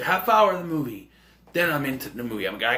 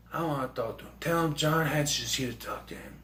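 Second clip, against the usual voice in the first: soft, hushed insistence; Seagal whisper; 8 dB quieter than usual. Retold or imitated. Hatcher insisting to the gangster, hushed. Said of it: "He whispers a lot".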